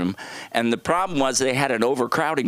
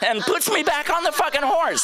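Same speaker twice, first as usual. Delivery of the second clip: brother's voice. Retold or imitated. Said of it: "my brother picks me up and goes".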